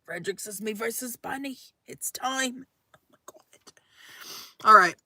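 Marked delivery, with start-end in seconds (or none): 0.6-2.7 hushed quote. Retold or imitated. Reading a challenge from chat. 2.9-3.7 breathy whisper. Real, not acted. Reacting to the challenge.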